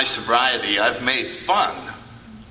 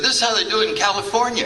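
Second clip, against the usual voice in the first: higher and fast, mocking the newcomers' way; mock California old-timer; 10 semitones higher, faster than usual. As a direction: rattle it off high like a smug local. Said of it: "and they said".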